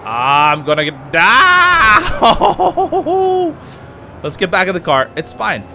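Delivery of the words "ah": high scream breaking into laughter; shriek, then laugh it off; a real yelp that turns into a laugh; startled scream. Real, not acted. Startled in a car crash.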